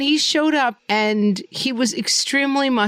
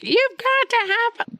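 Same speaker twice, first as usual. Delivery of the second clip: very high, drawn-out strained plea; hammed-up high-pitched insistence; mock overbearing parent; slower than usual. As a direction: imitate a parent pushing forced fun on a child. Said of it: "get in his face and be like".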